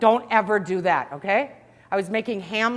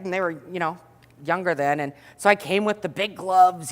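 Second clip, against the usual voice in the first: ordinary talk, nothing marked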